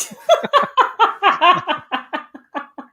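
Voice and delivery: high bursting laugh; cracking up helplessly; loud laughter. Real, not acted. Reacting to Yentl casting.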